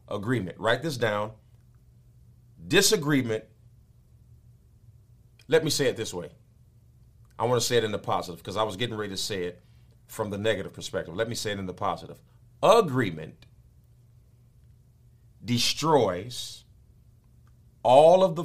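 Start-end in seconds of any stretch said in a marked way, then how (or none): none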